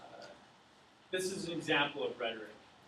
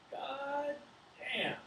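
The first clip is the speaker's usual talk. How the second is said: God damn jumping much higher in pitch; amazed farmer; 11 semitones higher than usual. Acted. John reacting to the race hog prize, high amazed exclamation. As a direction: let John's astonishment leap up high.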